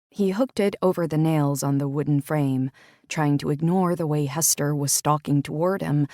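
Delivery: usual speaking voice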